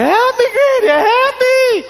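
put-on high, loud child crying help; pleading toddler voice. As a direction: beg like a desperate little kid. Imitating grandson crying for help.

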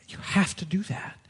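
hushed urgent whisper. Real, not acted. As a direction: drop to a whisper to press the point. Preacher drops to whisper.

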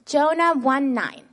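loud, clear leading call for the class to echo; call-out for children to repeat. Shown as a demonstration. Teacher calling the verse for children to echo back. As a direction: lead the kids' recitation, calling each phrase out. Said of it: "we're gonna say the Bible verse together".

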